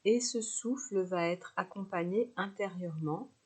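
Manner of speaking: usual speaking voice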